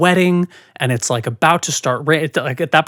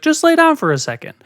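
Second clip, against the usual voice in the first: much higher, pressing imitation of mom; mother insisting; 13 semitones higher, 3 dB louder, faster than usual. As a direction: mimic a mother brushing off a question and insisting. Mother insisting after being questioned.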